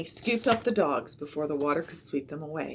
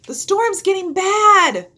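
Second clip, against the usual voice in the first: higher, louder, slow shout over the storm; 14 semitones higher, 13 dB louder, slower than usual. A narrator's reading. Annie shouting to Jack over the storm, a loud slow shout. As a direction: yell it through driving rain. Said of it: "shouted Annie".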